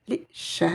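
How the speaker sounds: "Li She" with a doubled, held SH; insistent sh. Shown as a demonstration. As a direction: drop the l and lean on She. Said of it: "je vais insister sur cette lettre".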